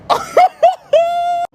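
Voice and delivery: very high mock cackle; high-pitched laughing fit; retold laugh. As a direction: burst into a high gleeful laugh. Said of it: "And I was like".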